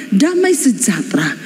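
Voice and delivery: usual speaking voice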